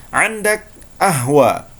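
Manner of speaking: slow Egyptian Arabic, ahwa with the qaf dropped; Egyptian Arabic accent. Shown as a demonstration. Teacher showing students the Egyptian ahwa. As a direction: drop the q like a Cairo local. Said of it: "orang Mesir akan mengatakan".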